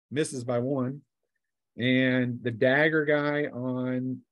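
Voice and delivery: ordinary delivery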